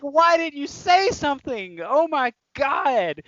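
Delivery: high, very drawn-out whine of disbelief; pleading with the friend in disbelief; exasperated disbelief. Retold or imitated. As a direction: stretch it out in exasperation.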